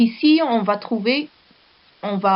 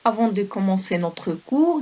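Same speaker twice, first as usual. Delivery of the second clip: ordinary delivery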